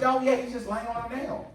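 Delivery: put-on breezy, unbothered older lady; Auntie Deborah. The auntie brushes off her nephew's worry, in a high easygoing voice. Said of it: "She goes".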